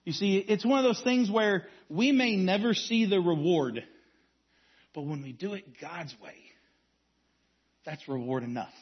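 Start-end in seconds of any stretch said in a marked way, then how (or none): 4.9-8.7 dropped low. Real, not acted. Sermon point dropped to near hush.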